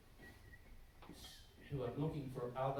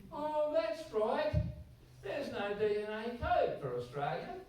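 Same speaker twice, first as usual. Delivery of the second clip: high, slow, louder sarcastic "oh, that's right"; sarcastic mock realization; 12 semitones higher, 7 dB louder, slower than usual. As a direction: drag it out with mock surprise, pitched up high. Sarcastic retort to a critic.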